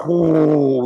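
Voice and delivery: long drawn-out whoo; imitated wind howl. Survivor imitating the tornado's approach, howling whoo. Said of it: "like, a fucking howl".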